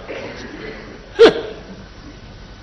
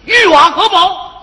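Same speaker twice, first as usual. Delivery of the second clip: high-pitched, declamatory classical recitation; mock-scholarly reciting in a raised voice; quoting the ancients; 5 semitones higher than usual. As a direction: intone it like an ancient maxim, line by line. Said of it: "古人说得好".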